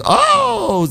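very high sharp oh; high cry. Acted. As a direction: yelp it out high. Gingerbread man being eaten.

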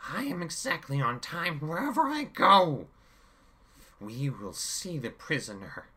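high, loud cold villain; angry villain. A narrator's reading. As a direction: snap it with menace. Chaos angry after striking.

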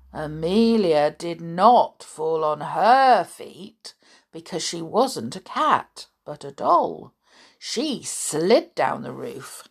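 put-on lively read-aloud voice for children; animated children's story narration; storytelling narration. A narrator's reading.

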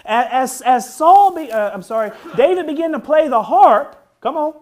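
fervent high, loud preaching. Acted. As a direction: preach it with rising fire. Preaching at full force.

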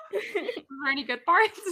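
laughing. Real, not acted. Laughing while asking about trip.

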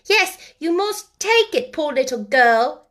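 put-on high, slow, loud child's insistence; stoutly insisting boy. A narrator's reading. Small boy pressing sixpence on a girl, stoutly insistent. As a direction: insist like a determined little boy. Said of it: "he insisted stoutly".

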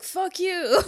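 high, loud rude retort; mock boyfriend snap. Boyfriend voiced in retelling.

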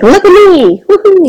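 high playful showing off; mock look-at-me squeal; excited. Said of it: "I'm like".

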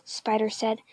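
ordinary delivery

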